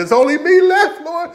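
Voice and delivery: high, loud self-pitying cry to the Lord; mock Elijah lament. Elijah complaining to the Lord he's alone, mock lament. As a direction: whine it like a lonely prophet. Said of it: "you're gonna be like Elijah".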